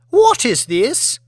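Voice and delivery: high, slow, disdainful demand; a pampered lady recoiling at the food; a spoilt aristocrat's outraged question; haughty lady. Acted. Aristocrat displeased with her eggs.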